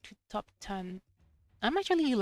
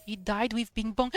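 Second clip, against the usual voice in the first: usual speaking voice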